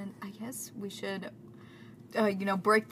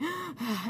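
out-of-breath gasp; 6 semitones higher than usual. Retold or imitated. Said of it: "and I'll be like".

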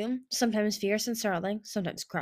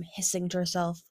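ordinary delivery, nothing marked

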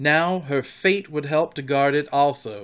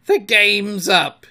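loud, high, drawn-out lament; anguished pirate captain's outcry; passionate cry; 7 semitones higher, 9 dB louder, slower than usual. A narrator's reading. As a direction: declare it slowly as a beaten captain. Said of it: "he spoke passionately".

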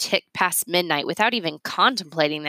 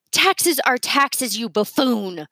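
slow, higher, disdainful voice; haughty Miss Sparks; 5 semitones higher, slower than usual. A narrator's reading. Miss Sparks sneering at Mister Yancey, slow and haughty. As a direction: drag out the contempt.

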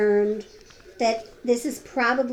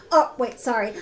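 normal talking voice

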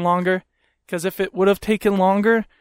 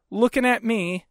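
usual speaking voice

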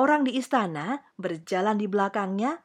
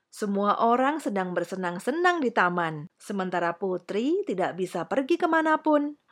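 ordinary delivery, nothing marked

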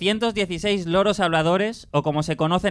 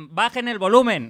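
megaphone voice; 6 semitones higher, 4 dB louder than usual. Retold or imitated. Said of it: "policías estaban con cinco megáfonos".

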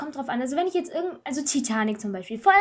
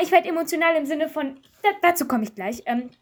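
usual speaking voice

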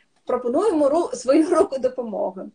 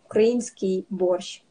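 normal talking voice

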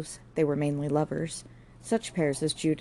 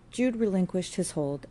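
usual speaking voice

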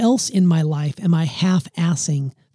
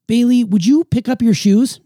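asking question; 5 semitones higher than usual. Shown as a demonstration. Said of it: "I would always ask questions".

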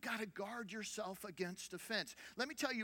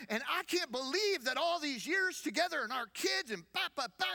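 retold angry outburst; 6 semitones higher, 4 dB louder than usual. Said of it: "she is fired up".

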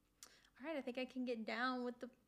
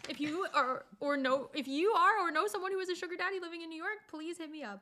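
excited plug; 5 semitones higher, 5 dB louder than usual. Real, not acted. Pleading ad plug for sugar daddy.

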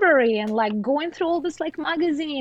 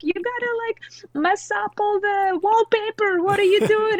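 imitating mom yelling; 9 semitones higher than usual. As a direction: act out a nagging mom, voice way up. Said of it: "yelling at me".

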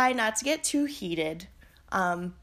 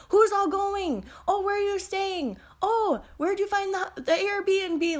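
chatty cashier in a much higher voice; nosy cashier; 10 semitones higher than usual. Retold or imitated. Cashier quizzing the narrator at the checkout, much higher voice. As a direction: pitch up high and pry like a talkative cashier. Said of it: "And she's like".

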